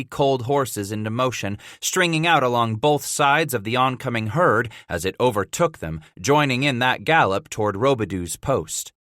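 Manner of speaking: ordinary delivery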